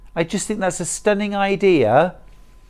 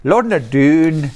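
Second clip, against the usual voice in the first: drawn-out mock Scottish accent; 4 dB louder, slower than usual. Presenter repeating the quilt's name in a mock Scottish accent. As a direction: stretch the name slowly in put-on Scottish. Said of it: "do a Scottish accent there".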